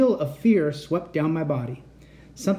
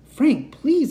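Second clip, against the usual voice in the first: high, loud desperate plea; frightened plea; 11 semitones higher, 6 dB louder than usual. Acted. Cat pleads with the robot blocking her path, high and frightened. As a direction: beg the robot to stop, scared.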